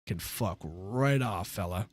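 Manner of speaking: slow, low and soft brush-off; mock dismissive. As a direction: drag out a mocking kiss-off under the breath. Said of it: "I was like".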